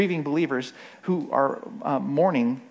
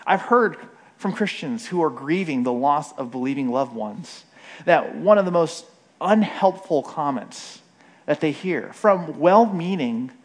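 normal talking voice

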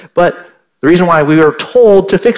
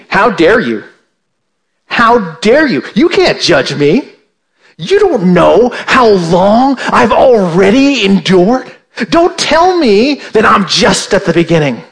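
imitating an offended listener, a touch louder and higher; mock indignant listener; 4 semitones higher, 3 dB louder than usual. Preacher voicing a listener who takes offence, mock indignant. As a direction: play the hurt churchgoer pushing back. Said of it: "and you're thinking".

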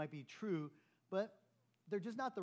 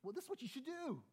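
know-it-all advice; 10 semitones higher than usual. Shown as a demonstration. Pastor mocking an eager advice-giver, high-pitched. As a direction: jump in with the fix, superior. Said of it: "first thing you should not say".

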